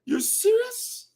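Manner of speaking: high, drawn-out disbelief; can't believe what he's hearing; incredulous. Real, not acted. Incredulous at leaders boasting of victory, disbelieving.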